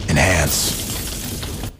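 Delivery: breathy whispered dramatic enhance; TV detective catchphrase. Acted. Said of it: "As I say on T V".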